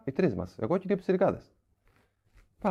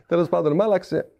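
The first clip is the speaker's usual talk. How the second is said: ordinary talk, nothing marked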